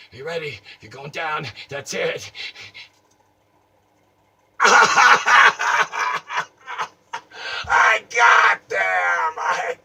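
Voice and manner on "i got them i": triumphant yell. Acted. Triumph over the mime.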